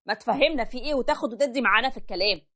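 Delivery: normal talking voice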